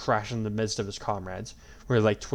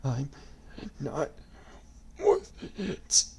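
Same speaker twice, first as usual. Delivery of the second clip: dying gasp; 5 semitones higher, much slower than usual. A narrator's reading. Dying man.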